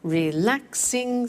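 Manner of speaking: Relaxing drawn out slowly, re-LAX-ing; slow teacher model; slow syllable demo. Shown as a demonstration. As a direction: stretch Relaxing for counting.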